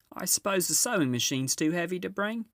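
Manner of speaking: a brisk, busy housewife giving orders; Mrs Beaver. Acted.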